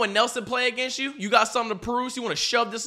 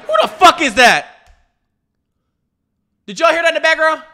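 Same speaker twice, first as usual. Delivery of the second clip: loud, raised-pitch challenge; outraged demand; 7 semitones higher, 10 dB louder than usual. Real, not acted. Viewer hearing someone in the background, outraged demand. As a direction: demand to know, loud and affronted. Hears a heckler in the video.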